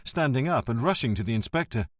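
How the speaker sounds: plain reading voice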